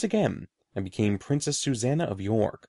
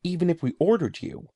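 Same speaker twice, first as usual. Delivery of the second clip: high, pleading question; 7 semitones higher than usual. A narrator's reading. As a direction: push back in disbelief. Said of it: "Edward asked".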